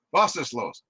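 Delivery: startled Was ist los in a German voice; a soldier caught off guard snaps a question. Acted. Soldier caught off guard by Baltimore, startled German. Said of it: "he just steps back".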